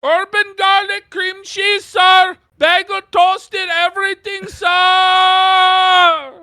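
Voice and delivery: high, shouted caricature order-taker voice; fluttering cries for help. Pretend. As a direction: rattle off orders in a high hammed-up voice. Caricature Tim Hortons worker voice.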